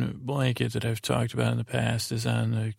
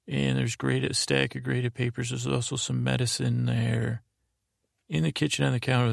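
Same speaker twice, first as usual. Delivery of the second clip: ordinary delivery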